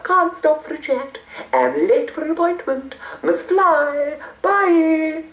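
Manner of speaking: high hurried fairy; a sweet high fairy in a rush. A narrator's reading.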